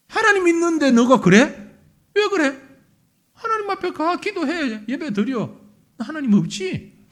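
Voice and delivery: loud, high sneering taunts; mocking taunt. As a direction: jeer at the believer, voice climbing. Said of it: "조롱하고 있는 거야". (불신자가 신자를 비웃는 높고 큰 조롱)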